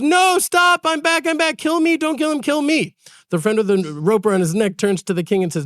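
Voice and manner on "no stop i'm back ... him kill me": high desperate shout, running in breathless; desperate cry. Retold or imitated. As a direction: cry out to stop the execution, voice pitched up high. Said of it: "He says".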